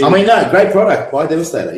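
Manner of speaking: hammed-up ad voice; mock sales pitch. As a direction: sell it like a cheesy toy ad.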